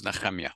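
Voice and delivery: Nehemiah as neh-KHEM-yah, throaty kh; Hebrew pronunciation. Shown as a demonstration. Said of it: "I always think of it as".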